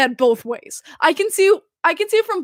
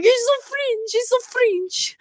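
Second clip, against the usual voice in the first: high, squealed retold gushing; gushing fangirl; 8 semitones higher, 3 dB quieter, slower than usual. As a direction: squeal it like a smitten fan. Retells her own gushing fangirl reaction.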